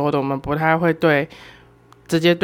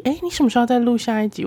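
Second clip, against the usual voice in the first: faster, pestering repeat; repeated nagging; 6 semitones higher, faster than usual. Retold or imitated. 学姐再次催问下一集，更快更急地问. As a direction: rush the same question again, nagging. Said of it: "而且她还一直说".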